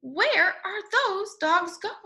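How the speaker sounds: usual speaking voice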